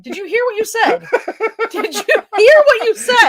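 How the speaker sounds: asked through laughter, high and rising; laughing disbelief. Real, not acted. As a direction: laugh while asking, amused. Laughing at co-host's slip.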